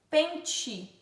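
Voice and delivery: "pente" ending in "chee"; T as tch. Shown as a demonstration. Teacher showing own way, "pente" with tch ending. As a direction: say "pente" as PEN-tchee. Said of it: "Quando a gente fala tch".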